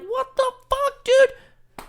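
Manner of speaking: very high, slow outraged cry; outraged disbelief. Retold or imitated. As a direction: throw your hands up in disbelief. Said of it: "and I'm like".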